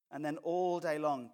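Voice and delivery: normal talking voice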